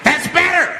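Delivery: high, strained approval; crazed satisfaction. Retold or imitated. Man approving the silenced bus, high crazed voice.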